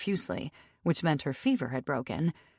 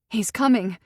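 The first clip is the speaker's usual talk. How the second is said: higher, louder sudden blurt; startled urgent announcement; 6 semitones higher, 7 dB louder than usual. Acted. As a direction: let it burst out before thinking. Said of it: "she blurted out".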